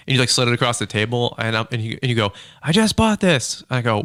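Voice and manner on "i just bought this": high, drawn-out mock call over noise; imitated excitement. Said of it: "you were all excited".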